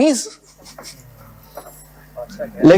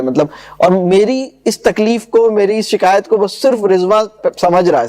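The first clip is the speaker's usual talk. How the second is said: ordinary talk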